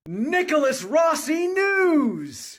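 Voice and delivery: slow, high and loud, like a show intro; announcer call of the show title; show title shout. Acted. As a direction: belt out the show's name slowly.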